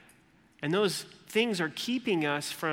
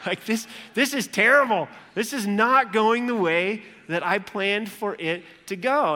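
louder, higher fed-up rant; frustrated Martha; 4 semitones higher, 6 dB louder than usual. Retold or imitated. Martha fumes while making deviled eggs, loud. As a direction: boil over in the kitchen. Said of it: "she's like".

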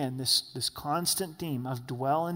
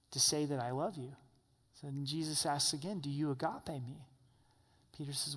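softer, intimate preaching voice; tender sermon; 6 dB quieter than usual. Acted. Pastor retelling Peter's restoration to his flock, tender. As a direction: draw them close and speak gently.

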